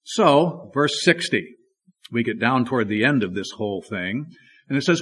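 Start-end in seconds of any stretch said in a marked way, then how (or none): none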